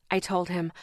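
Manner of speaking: ordinary delivery, nothing marked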